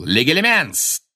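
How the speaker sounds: Легилименс shouted slowly with a falling end; shouted spell. A narrator's reading. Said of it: "Снег уже выкрикнул".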